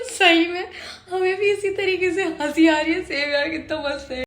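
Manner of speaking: usual speaking voice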